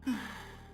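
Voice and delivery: long, soft exhale; soft sigh. Pretend. AI host pausing in a moment of joy, soft sigh. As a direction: let out a quiet, airy sigh. AI host pauses before apologizing.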